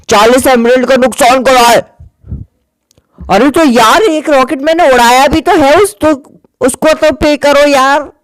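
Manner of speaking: haggling over emeralds, mock annoyed; mock angry. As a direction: demand payment in a put-on voice. Quarrel over rocket payment.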